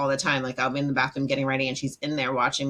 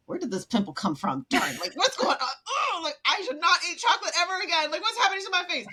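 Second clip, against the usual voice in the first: mock dismay; 13 semitones higher than usual. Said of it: "I'm like".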